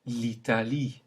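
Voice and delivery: l'Italie with clear French i sounds. Shown as a demonstration. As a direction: keep each i crisp. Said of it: "Note the E at the beginning".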